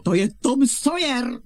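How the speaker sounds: loud, proud announcement; triumphant reveal. Acted. Aunt reveals her nephew to her husband, triumphant. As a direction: announce the surprise guest with a flourish.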